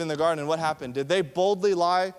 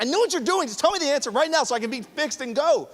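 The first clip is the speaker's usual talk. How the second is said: exasperated; 7 semitones higher, faster than usual. Retold or imitated. Impatient listener being probed.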